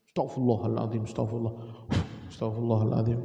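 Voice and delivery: low, even recitation of istighfar; murmured dhikr. Shown as a demonstration. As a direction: repeat it evenly on a low note. Demonstrates repeated istighfar.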